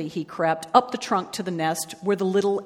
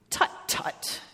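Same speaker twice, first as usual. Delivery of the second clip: soft tongue-clicked tut-tut; dismissive tutting; 7 dB quieter than usual. Acted. Maisie answers the doubtful elephant, dismissive tutting. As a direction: tut softly, brushing the objection aside.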